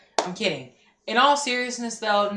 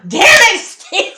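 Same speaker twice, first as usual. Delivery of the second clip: frustrated shout; 13 semitones higher, 13 dB louder, slower than usual. Real, not acted. Friend steals her pick.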